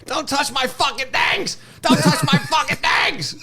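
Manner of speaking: high-pitched mock shriek of a ranting woman; imitated screaming. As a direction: screech it slowly, imitating a furious stranger. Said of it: "coming towards us screaming".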